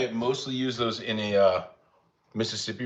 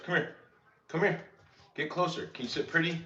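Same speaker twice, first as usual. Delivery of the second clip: high, coaxing sing-song for a dog; pet-talk to the dog; 7 semitones higher, 5 dB quieter than usual. Real, not acted. Coaxing dog Archie to show himself, high pet-talk voice. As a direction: coax the pup closer, voice up high.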